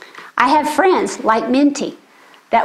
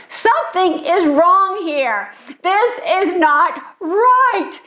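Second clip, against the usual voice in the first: put-on high, tearful child's plea, slowing at the end; crying child; 7 semitones higher, slower than usual. Little girl on stage crying to everyone that it's wrong. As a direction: sob it out like a scared four-year-old. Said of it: "trying to convince everybody".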